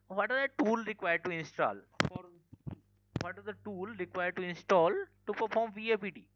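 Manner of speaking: projecting so the audience can hear; pushing the voice out over a weak mic; speaking up. Real, not acted. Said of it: "Anyone tell me my voice is still low?".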